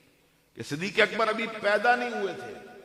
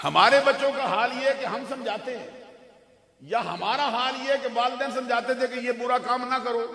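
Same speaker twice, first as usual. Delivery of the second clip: high, raised sermon voice building in force; impassioned preaching; 6 semitones higher, 9 dB louder than usual. Acted. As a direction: build the contrast to a loud peak.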